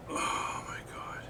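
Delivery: breathy whispered aside. Real, not acted. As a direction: whisper it under your breath.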